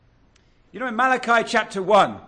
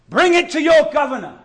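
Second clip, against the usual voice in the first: "Bring it to your governor" pushed higher and louder, YOUR stressed; forceful challenge; 5 semitones higher, 5 dB louder than usual. Acted. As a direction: throw the challenge at the hearers.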